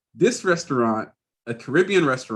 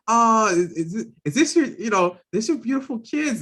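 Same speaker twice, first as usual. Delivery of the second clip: high, gushing stranger voice; gushing lady; 9 semitones higher than usual. Retold or imitated. Museum lady gushes over the cousin's kids. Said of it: "when the lady says".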